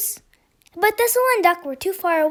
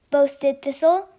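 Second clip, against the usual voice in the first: usual speaking voice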